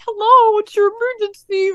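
high-pitched imitation of a dispatcher; emergency operator. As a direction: answer the line in an operator's singsong. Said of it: "And the operator answered".

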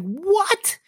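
high, incredulous what; stunned what. Retold or imitated. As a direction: shoot it up in shock. Retold shock at first class.

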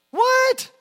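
high, loud disbelieving yelp; mock incredulity. Preacher reacting to a ridiculous online post, incredulous. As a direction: burst out in shrill-pitched disbelief.

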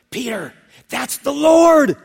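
loud, high, drawn-out cry; acted thrilled shout, stretched on the last word; excited recognition. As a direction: shout the sudden discovery to a friend. John suddenly recognizes Jesus, calls Peter.

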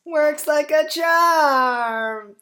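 put-on slow, high, self-satisfied child; smug kid voice. Kid pleased with himself.

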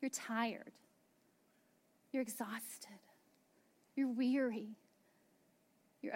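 breathy whisper of exhaustion; worn-out whisper. Acted. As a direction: whisper it like you have no air left. Tired, weary listeners described.

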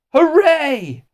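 high, loud drawn-out cheer. A narrator's reading. Class hearing their teacher will stay, jubilant cheering. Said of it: "they all said".

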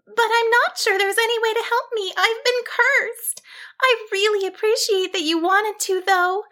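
high-pitched, mildly sad sheep; understated sorrow, small high voice; sorrowful. A narrator's reading. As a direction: decline sadly in a high little voice. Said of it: "she concluded sorrowfully".

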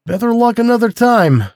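high-pitched call to the hounds. A narrator's reading. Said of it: "and he called".

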